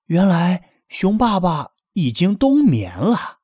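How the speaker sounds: ordinary delivery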